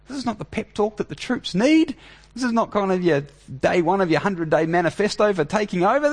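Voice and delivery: high, pleading and incredulous; exasperated Peter. Retold or imitated. Peter rebukes Jesus in high exasperation. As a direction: scold him like a panicked advisor. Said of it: "Peter can't take it".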